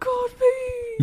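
high, loud shocked babe. Real, not acted. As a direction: squeal it in disbelief. Shocked reaction to friend.

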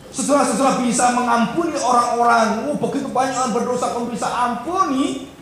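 loud, high-pitched sermon delivery; forceful preaching. Acted. Preaches with raised voice.

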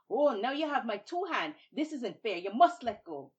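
loud, high protest; outraged complaint. Acted. Babuki, both hands stuck, protesting loudly. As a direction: complain loudly that it's unfair.

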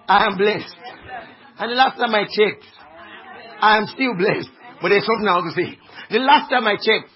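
loud, forceful preaching; emphatic declaration. Real, not acted. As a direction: declare it boldly to the whole congregation.

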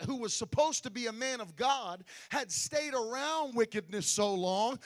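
ordinary talk, nothing marked

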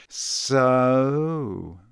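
long, drawn-out opener; lingering, deliberate drawl; slowly. A narrator's reading. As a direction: stretch the word out slowly. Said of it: "said Malfoy slowly".

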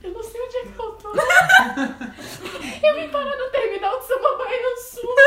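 high mock-tearful voice, laughing; retold desperate crying. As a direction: whimper to your aunt, then build to a loud wail. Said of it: "Eu chorando, eu chorando, desesperada".

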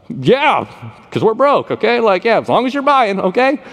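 high-pitched, jokey yes; comic high-voiced eagerness from a broke man; eager acceptance.

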